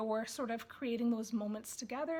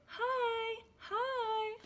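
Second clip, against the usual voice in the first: much higher, louder, delighted; excited baby talk; 11 semitones higher, 4 dB louder, slower than usual. Shown as a demonstration. Parent teaching baby to wave, in high excited baby talk. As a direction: greet the boy like baby is meeting him. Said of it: "On this page you could say".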